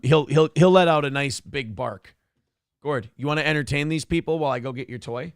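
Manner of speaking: normal talking voice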